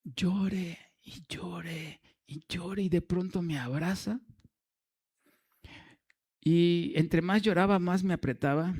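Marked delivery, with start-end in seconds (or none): 0.0-4.2 whispered telling. A narrator's reading. Preacher whispering how the prisoner wept in his arms. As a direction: drop to a higher breathy whisper. Retelling the man crying.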